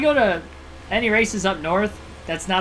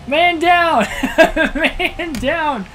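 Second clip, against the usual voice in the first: laughing mock soldier cry, high and loud; man down shout; 10 semitones higher, 6 dB louder, much slower than usual. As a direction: yell it like a battlefield call. Mock war call after wreck.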